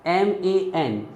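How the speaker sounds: usual speaking voice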